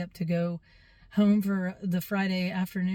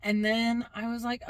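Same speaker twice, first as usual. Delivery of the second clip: ordinary delivery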